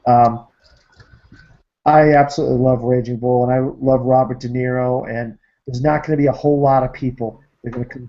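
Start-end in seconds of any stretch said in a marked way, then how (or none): none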